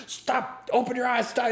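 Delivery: high-pitched yell, held back in volume; mock yelling. Bartender imitates guy ordering girl, mock yelling. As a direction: imitate a frantic man's yelled orders. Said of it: "he's yelling at her".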